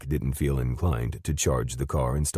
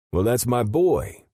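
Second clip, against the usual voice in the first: a hint of a gruff, smug man, higher and slower; smug father; 11 semitones higher, 5 dB louder, slower than usual. Derek's father boasting to Jim, lightly voiced smug man. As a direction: drawl out the pride in his boy.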